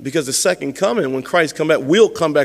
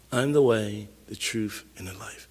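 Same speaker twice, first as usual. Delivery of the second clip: much softer, lower, "I'm the way, the truth, and the life" almost breathed; hushed; 8 semitones lower, 10 dB quieter than usual. Acted. Preacher quietly repeating Jesus's words to Thomas, hushed. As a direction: let it fall to a near-hush.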